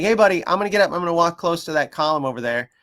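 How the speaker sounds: high, loud friendly announcement; talking to a dog. Retold or imitated. Trainer telling dog where she'll walk, friendly announcement. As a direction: give the dog a lifted, friendly heads-up. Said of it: "I was like".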